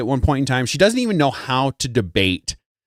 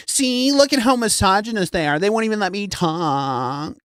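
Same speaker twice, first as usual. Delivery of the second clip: mock victim outrage; 7 semitones higher than usual.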